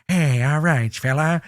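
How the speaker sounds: lightly voiced old movie gangster; a hint of a tough-guy gangster; gangster impression. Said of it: "they're like".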